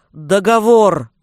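loud, insistent one-word shout; forceful demand. A narrator's reading. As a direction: hurl it out as a final demand. Desperate final demand to the forest master. (громкое, настойчивое требование)

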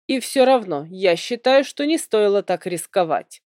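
ordinary delivery, nothing marked

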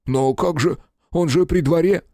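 high, pleading voice of a frightened man; nervous photographer voice. A narrator's reading. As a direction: beg and babble like a scared little man.

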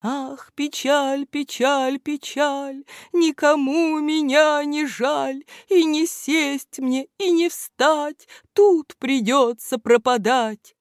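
high, slow mournful lament from inside a sack; sad plaintive wail. A narrator's reading. Trapped in a sack, lamenting to no one: high slow wail. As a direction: wail it like a sorrowful little creature trapped.

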